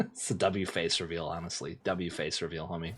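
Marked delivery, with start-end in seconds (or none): none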